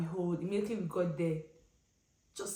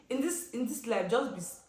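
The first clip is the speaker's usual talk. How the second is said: usual speaking voice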